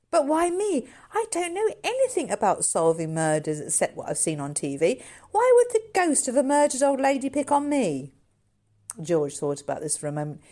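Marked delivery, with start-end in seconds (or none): 0.1-8.1 lightly voiced girl, higher and louder, puzzled; a hint of a bewildered girl, slowing at the end; girl Sandy.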